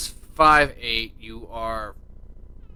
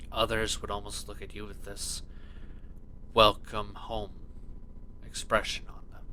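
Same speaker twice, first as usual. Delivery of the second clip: soft scene narration; 5 semitones lower, 14 dB quieter than usual. A narrator's reading. GM sets scene softly.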